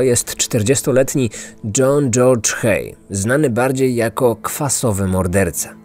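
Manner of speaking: ordinary delivery, nothing marked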